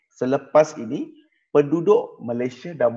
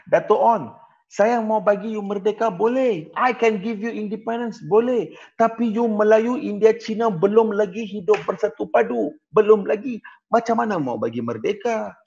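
lightly voiced, higher British colonial officer; 8 semitones higher than usual. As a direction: talk down to Dato' Onn, a little higher and mocking. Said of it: "British beritahu dengan Dato' Onn Jaafar".

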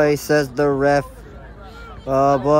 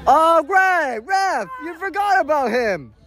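yelling from the sidelines; 8 semitones higher than usual. Real, not acted. Sarcastic yell at the ref.

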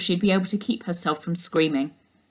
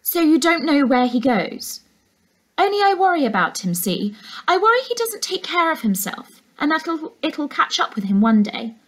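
insistent housekeeper; 7 semitones higher than usual. A narrator's reading. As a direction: press her with false worry. Housekeeper's mask slipping.

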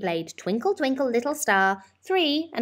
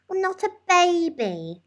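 put-on child's mutter; 12 semitones higher, 4 dB louder than usual. A narrator's reading. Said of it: "muttered the little one".

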